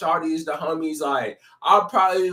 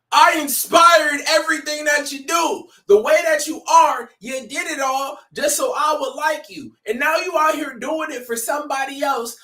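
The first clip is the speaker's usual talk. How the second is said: hyped-up retelling; 10 semitones higher than usual. Retold or imitated. Fan reacting to the song, high and fired up. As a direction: preach the lyric like it's gospel. Voicing [name]'s lyric, high and loud.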